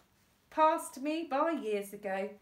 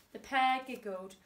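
plain reading voice

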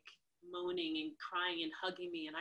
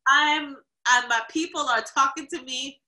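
loud, high emphatic claim; mock dramatic; 6 semitones higher, 14 dB louder than usual. Insisting to the driver about ancestors, loud mock drama. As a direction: overwrought hammed-up plea. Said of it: "I'm like".

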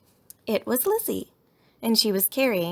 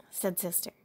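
ordinary delivery, nothing marked

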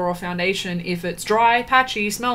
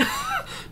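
exasperated laugh; 7 dB quieter than usual. Real, not acted. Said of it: "I can't help but sit here and be like".

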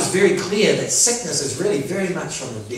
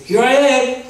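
higher, louder ready reply; prompt answer to a call; 7 semitones higher, 5 dB louder than usual. Retold or imitated. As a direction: answer the summons straight away. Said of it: "Straight away".

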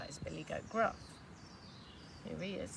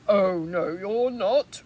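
much louder troll roar; bellowing refusal; 14 dB louder, much slower than usual. Acted. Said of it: "roared the troll".

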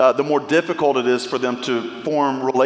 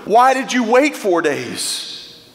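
Martha's plea; 7 semitones higher than usual. Acted. Preacher voicing Martha asking Jesus why he waited, pleading. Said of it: "imagine Mary, uh, Martha asking the question".

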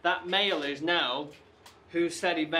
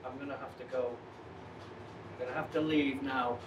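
withdraw quietly, needing to leave; upset; 2 semitones lower, 8 dB quieter, faster than usual. Real, not acted. Investigator says he must leave, upset and subdued. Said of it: "what's upset [name]".

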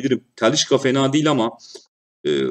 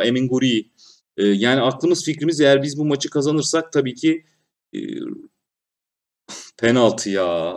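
normal talking voice

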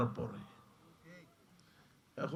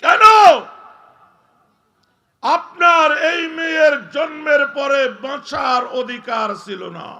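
high, loud, slow thundering shout; full-force preaching; 11 semitones higher, 17 dB louder than usual. Shown as a demonstration. Preacher warning fathers of daughters, booming shout. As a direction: hammer the point home at the crowd. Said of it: "আমি আস্তে আস্তে বলতেছি".

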